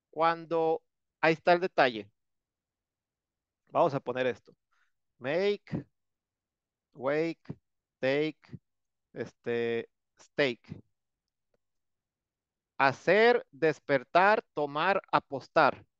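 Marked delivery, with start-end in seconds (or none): 5.2-10.8 Make, wake, take, stake each read out slowly on its own, ending in a rise; teacher models Make, wake, take, stake for the class; english words demo. Shown as a demonstration. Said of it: "Todos terminan en E".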